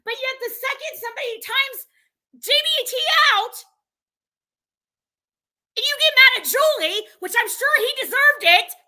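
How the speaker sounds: heated rant at a streamer; real anger. Real, not acted. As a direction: let the anger climb to a shout. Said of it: "I'm fucking mad".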